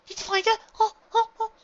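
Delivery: high, loud eager little filly voice; bursting with excitement, squealing high; excited young filly. A narrator's reading. Eager young filly greeting her returning sister, high and loud.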